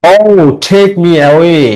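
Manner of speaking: very slow, measured recitation with drawn-out words; slow classroom verse recital with pauses; model poem reading. Shown as a demonstration. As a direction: read the poem aloud for students, stretching each phrase. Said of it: "এরকম ভাবে মানে কবিতাটি পড়তে বলছে".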